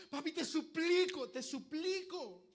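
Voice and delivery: high, loud, drawn-out begging; acted cry of pain. Child crying out in pain to father, high pleading shout. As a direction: beg at a shout, stretching the words. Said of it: "imagínense a uno gritando de dolor".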